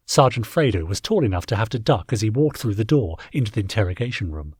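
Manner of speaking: ordinary delivery, nothing marked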